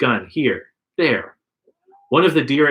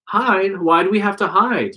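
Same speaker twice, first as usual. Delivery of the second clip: demanding; 8 semitones higher than usual. A narrator's reading. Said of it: "you demand".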